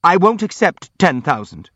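indignant refusal. A narrator's reading. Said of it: "said Burdovsky".

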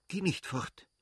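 cling to him with your voice; urgent plea. Acted. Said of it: "stieß sie rasch hervor".

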